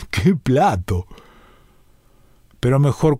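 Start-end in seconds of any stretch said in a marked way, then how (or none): 0.1-1.1 a slow laugh running into the words; laughing fondly. Acted. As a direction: chuckle it out, savouring the memory. Old boxer recalls a great punch. (riéndose despacio, con gusto)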